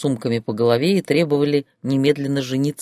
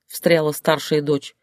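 normal talking voice

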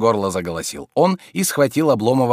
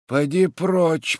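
slow, higher-pitched grumble; grumbling; 8 semitones higher, much slower than usual. A narrator's reading. Sleepy master sending servant away, slow grumble. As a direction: grumble it drowsily and slowly. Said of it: "проворчал Илья Ильич".

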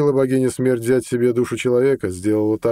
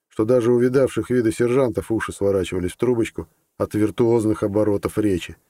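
ordinary delivery

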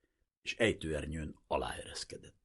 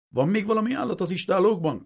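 higher, louder barked question of a German sergeant; commanding; 14 semitones higher, 9 dB louder than usual. A narrator's reading. German sergeant questions the prince, commanding and loud. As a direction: demand it like an officer who expects obedience. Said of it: "kérdezte parancsoló hangon".

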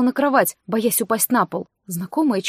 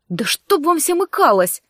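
higher-pitched angry outburst; snapped curse, pitch raised; barked curse; 5 semitones higher, 4 dB louder than usual. A narrator's reading. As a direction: bark it out in exasperation. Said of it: "рявкнула я".

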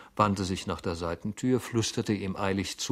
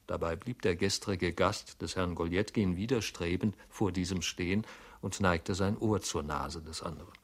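ordinary delivery

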